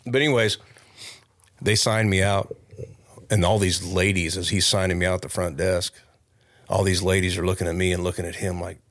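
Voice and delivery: ordinary delivery, nothing marked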